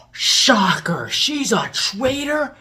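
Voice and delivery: loud, drawn-out fake astonishment; mock shock. Reviewer mocking the traitor reveal, feigned shock. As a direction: act stunned by an obvious twist. Mock surprise at plot twist.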